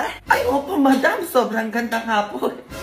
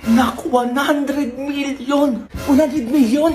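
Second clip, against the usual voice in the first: excited; 3 semitones higher, much slower than usual. Acted. Mother announces lottery win.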